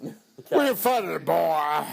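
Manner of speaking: slow, drawn-out deadpan line; slow imitation. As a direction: stretch each word slowly. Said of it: "He doesn't say it like that at all".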